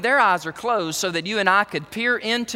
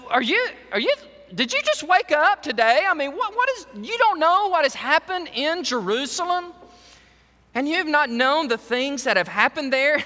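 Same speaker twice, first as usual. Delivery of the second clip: higher, incredulous needling question; sarcastic; 7 semitones higher than usual. Retold or imitated. Disciple mocking the stranger on the road, sarcastic. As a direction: act like he just woke up today. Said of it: "a tint of sarcasm here".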